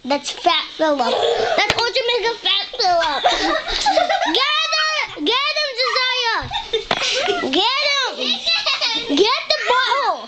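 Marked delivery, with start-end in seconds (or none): 3.3-4.3 high squeal of laughter; high burst of laughter. Real, not acted. Laughing at Fat Philip. 4.4-10.3 excited cheering. Real, not acted. Cheering on the fight.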